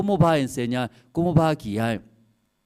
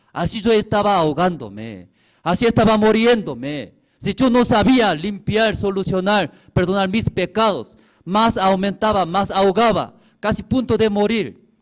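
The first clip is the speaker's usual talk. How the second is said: loud, high impassioned preaching; preaching at full force; 8 semitones higher, 8 dB louder than usual. Acted. Preacher to his congregation, loud and impassioned. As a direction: cry out the drowning in sin from the pulpit. Preacher raising voice about sin.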